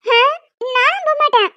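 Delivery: put-on loud, high childish protest; whiny refusal. Girl refusing to leave the room, whiny protest. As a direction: dig in like a sulky kid.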